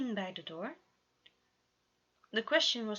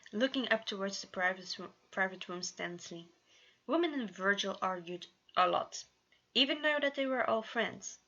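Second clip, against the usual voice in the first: plain reading voice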